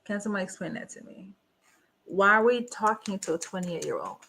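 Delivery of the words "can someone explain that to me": much softer, almost to herself, fast; quiet muttered repeat. Real, not acted. Reacting alone to the dating show, muttering it much softer. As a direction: drop the voice and mutter it in disbelief.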